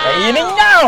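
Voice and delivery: high, loud mock command to eat; playful mock shout. Aunt playfully yells at the kids to eat chocolate, loud mock command. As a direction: bark it like a joking bossy aunt.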